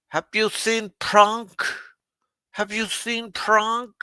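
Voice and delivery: high, loud worried wife calling out. Retold or imitated. Wife calling out high for her husband. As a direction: call out high like his anxious wife. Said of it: "Gilda will go".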